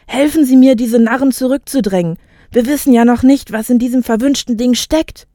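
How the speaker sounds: loud, urgent appeal for help; agitated man shouting for assistance; Ogilvy. Acted. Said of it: "rief Ogilvy".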